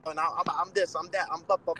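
a hint of a self-important braggart, lazily rattling off boasts; mocking a bragger. Mocking boxers who brag online, a lightly put-on show-off voice.

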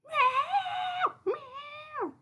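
very high loud meowing; high cat cry; yowling cat. Retold or imitated. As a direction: yowl it like a cat locked out. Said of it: "He's like".

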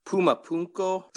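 Pumapunku in a Spanish accent. Shown as a demonstration.

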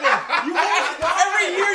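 laughing loud complaint. Real, not acted. Friend teasing about forgotten birthday.

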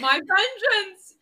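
slow, loud and high hammed-up vow; mock dramatic cry. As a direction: belt it out like a cartoon villain. Player mocks the villain line.

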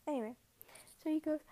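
usual speaking voice

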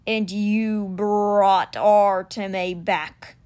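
very slow, weighty big cat voice; stone cheetah, stating. A narrator's reading. Stone cheetah confirms the boy's deed, slow and certain. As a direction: drag it out as a statement. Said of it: "not a question".

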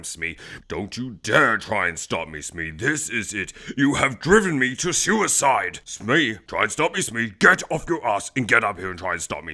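hammy villain captain, low grumbles rising to outbursts; theatrical tantrum at a hapless henchman; exasperated storybook villain. Acted. Hook-handed boss threatening to quit the podcast to Smee, hammy exasperation.

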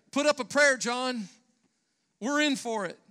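loud, high panicked cry; shouting for his life; terrified shout. A narrator's reading. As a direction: yell it in terror as the bull closes in. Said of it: "Terrified, one shouted to the other".

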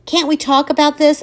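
high, pleading question. Acted. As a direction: beg her to talk it over. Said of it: "I plead".

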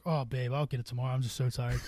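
soft, low, pretend-weary excuse; feigned tiredness. Drunk husband puts off his wife's errand, softly feigning tiredness. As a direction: beg off softly as if worn out. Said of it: "I just start pretending I'm super tired".

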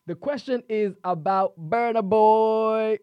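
slow, loud hype call; hype announcer. Acted. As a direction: call out to the fans. Host hypes [name] question.

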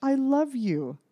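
exasperated. Real, not acted. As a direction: draw it out in disbelief. Exasperated rant about the ending.

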